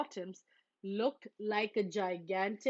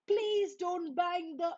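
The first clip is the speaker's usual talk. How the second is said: mild call; 8 semitones higher than usual. Acted. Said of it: "Brother cried".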